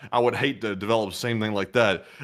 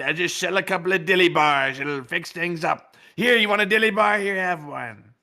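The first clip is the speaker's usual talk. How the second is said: caricature voice; 6 semitones higher than usual. Retold or imitated. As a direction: offer the dilly bar in a high caricature voice. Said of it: "we do the voices".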